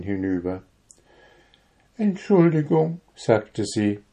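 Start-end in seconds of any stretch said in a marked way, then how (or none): 2.0-3.0 contrite. A narrator's reading. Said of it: "trippelte sie zerknirscht".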